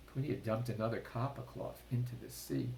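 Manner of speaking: usual speaking voice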